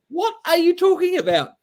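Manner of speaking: high, mock-bewildered outburst; baffled. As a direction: pitch it way up in disbelief. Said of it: "And it's like".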